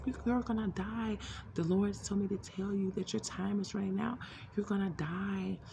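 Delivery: high, crying warning that he will die; pleading, tearful prophecy on the phone. Retold or imitated. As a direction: warn him through tears, pitch up. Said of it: "And I was crying".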